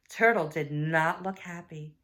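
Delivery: plain reading voice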